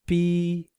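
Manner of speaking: PI held on a high, level pitch; flat first tone. Shown as a demonstration. Teacher matching syllables to the beep, high level tone. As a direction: sustain PI evenly like a beep. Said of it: "exactly the same tone".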